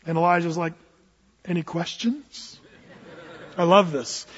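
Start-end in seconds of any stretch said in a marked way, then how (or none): 1.5-2.5 slow, soft understated question; understated deadpan. Acted. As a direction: toss it out coolly after a huge spectacle. Elijah's deadpan line after fire falls.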